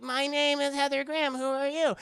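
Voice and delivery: high put-on actress voice; mock damsel on the phone. Voicing Heather on the phone.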